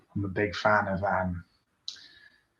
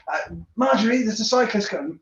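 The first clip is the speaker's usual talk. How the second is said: much higher fussy warning; mock rider friend, much higher; 10 semitones higher than usual. Friend warning Marjorie of the cyclist, much higher.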